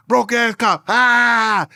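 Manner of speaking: mock rival yell. Mimicking the rival's insult for the laughing crowd, loud mock jeer. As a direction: bark it like a cartoon taunt.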